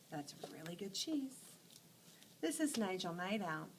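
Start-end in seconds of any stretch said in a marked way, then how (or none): 0.1-1.3 whisper. Acted. Mouse savoring cheese, whispered.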